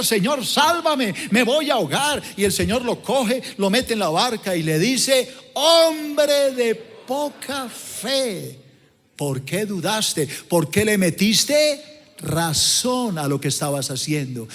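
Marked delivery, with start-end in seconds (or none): 0.0-2.2 mock desperate cry; retold cry for help. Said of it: "empezó a gritar". 5.5-14.5 solemn drawn-out rebuke of a doubter; Jesus rebuking. Retold or imitated.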